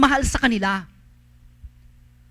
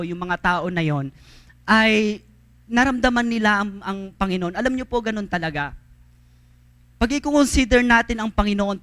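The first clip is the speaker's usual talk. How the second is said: ordinary talk, nothing marked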